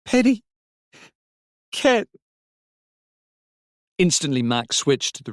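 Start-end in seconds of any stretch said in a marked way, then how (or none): 0.0-2.1 high, loud cries of names; acted child's distress, much higher; sobbing child. A narrator's reading. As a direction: call out tearfully, word by word. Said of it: "she sobbed".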